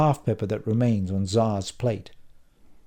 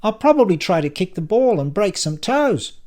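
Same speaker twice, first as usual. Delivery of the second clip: high, loud, running on; talkative Silvio; 10 semitones higher, 7 dB louder than usual. Acted. Silvio talks on as his glass is filled, high and loud. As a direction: keep chattering in a raised, high voice. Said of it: "without pausing in his speech".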